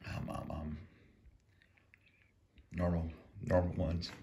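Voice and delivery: talking through a mouthful; chewing while speaking; mouth full. Real, not acted. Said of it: "Kinda hard to talk with your mouth full".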